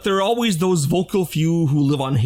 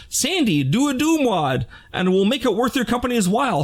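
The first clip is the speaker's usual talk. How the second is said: higher-pitched imitation of a company pitch; lightly voiced company offer; 6 semitones higher than usual. Voicing a hypothetical company's offer.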